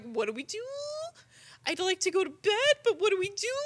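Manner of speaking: high fretful chicken whine; worried chicken voice. Retold or imitated. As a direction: play a sleepy hen begging for help. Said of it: "Just like".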